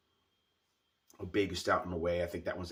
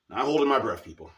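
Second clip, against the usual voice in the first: higher and louder, wry doubt; emphatic; 6 semitones higher, 11 dB louder than usual. Real, not acted. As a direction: fling it out with a knowing laugh. Wry doubt, then repeated.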